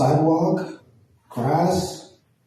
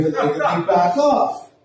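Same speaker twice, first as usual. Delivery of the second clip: ordinary delivery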